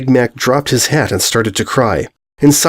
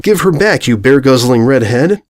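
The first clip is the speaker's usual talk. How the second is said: ordinary delivery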